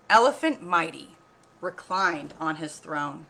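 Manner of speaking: expressive picture book reading to the class; story reading. A narrator's reading. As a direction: read it like a storybook for kids. Reads picture book aloud.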